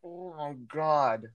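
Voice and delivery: slow, drawn-out exasperation; exasperated. Real, not acted. Reacting to a friend's story about misgendering, exasperated. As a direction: let it drag out, appalled.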